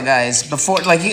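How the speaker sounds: ordinary talk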